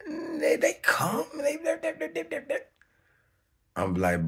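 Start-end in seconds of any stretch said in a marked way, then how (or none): 0.1-2.6 panicked stammer. Retold or imitated. Stammering, high, near crying.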